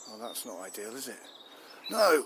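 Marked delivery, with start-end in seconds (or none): 1.9-2.2 a high, loud barked No; angry shout. Acted. Chief snaps at the minister's understatement, shouting. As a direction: explode at the question.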